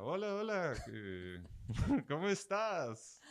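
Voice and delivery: high, slow mock Israeli accent greeting; imitating Israeli accent. The Israeli roommate greeting her at the door, mock Israeli accent. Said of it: "Era en inglés".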